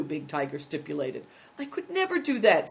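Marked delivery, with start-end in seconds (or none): 1.6-2.7 high, tearful refusal; beginning to cry. Acted. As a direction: refuse as the tears start. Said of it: "beginning to cry".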